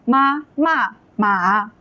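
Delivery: very slow, each syllable held; deliberate teacher's model reading; tone demonstration. Shown as a demonstration. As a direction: stretch out each Mandarin tone. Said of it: "ma is the third tone".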